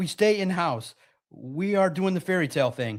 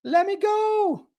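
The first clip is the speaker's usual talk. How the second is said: high, slow, whiny mock sob; mock crying plea; 14 semitones higher, much slower than usual. Quarterback begging the owner to let him go, mock crying. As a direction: ham up a quarterback begging to leave. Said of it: "cries and is like".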